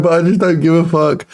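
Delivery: normal talking voice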